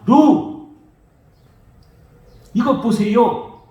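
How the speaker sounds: loud, high shout slowing into a retort; defiant shout. Retold or imitated. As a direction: hurl it back at father. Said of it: "아버지께 그렇게 대들고".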